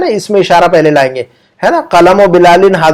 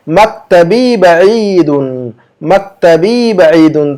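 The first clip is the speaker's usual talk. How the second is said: drawn-out, deliberate Arabic answer; very slow model answer; much slower than usual. Shown as a demonstration. Teacher gives the Arabic answer very slowly for students to repeat. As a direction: stretch each syllable for learners.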